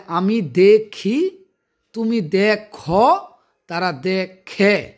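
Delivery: slow, word by word for students; slow recap. Shown as a demonstration. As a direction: spell out each form slowly like a teacher. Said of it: "slow recap of forms". (ধীরে ধীরে বুঝিয়ে বলা)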